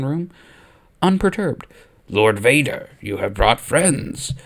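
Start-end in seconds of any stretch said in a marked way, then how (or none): 2.1-4.3 slow, probing Emperor voice; a sly questioning Emperor; inquisitive Emperor. A narrator's reading. Emperor probes Vader about his friends, slow and inquisitive. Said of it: "the Emperor asked inquisitively".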